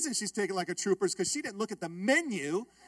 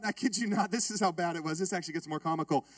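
ordinary talk

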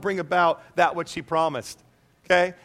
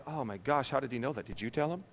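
low, hushed aside to a neighbour; imitated timid reaction, soft and low; timid murmur; 5 semitones lower, 10 dB quieter than usual. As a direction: voice a timid churchgoer quietly, well under your breath. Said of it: "too, too timid to".

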